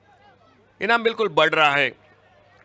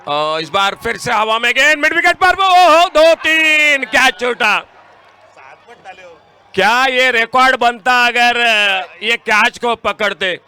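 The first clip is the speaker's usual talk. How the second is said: excited cricket commentary; 5 semitones higher, 4 dB louder than usual. Real, not acted. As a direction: gasp it out as the catch falls. Dropped catch called live. (उत्साहित commentary, धीमी खिंची चीख)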